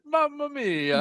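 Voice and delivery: high, hammed-up Italian "Mamma mia"; golfer's hammed-up Italian "Mamma mia"; mock Italian exclamation. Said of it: "he said".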